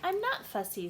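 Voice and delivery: put-on a kid insisting he's not fussy; Gregory voice. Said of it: "said Gregory".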